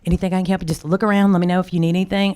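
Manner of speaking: high, eager welcome, tumbling out fast at the end; cheerful store greeting. Retold or imitated. Card shop owner welcoming a walk-in customer, high and eager. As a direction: greet a customer walking in, all enthusiasm. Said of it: "we'd say".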